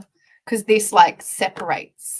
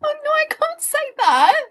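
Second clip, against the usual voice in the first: much higher, laughing refusal; laughing protest; 8 dB louder than usual. Real, not acted. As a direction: squeal it out through laughter.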